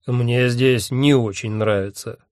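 slow, disdainful character voice; Odin-like dismissive drawl. A narrator's reading. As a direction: draw it out with lordly disdain. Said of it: "пренебрежительно сказал Один".

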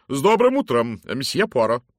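loud, hearty lady's greeting; booming matron. Acted. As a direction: hail him like a bossy matron. Mrs Masterton greets Poirot loudly. (громкий зычный голос дамы)

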